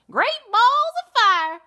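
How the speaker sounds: high, loud southern goose cry. Acted. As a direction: belt it out like a brash farm goose. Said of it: "cried the goose".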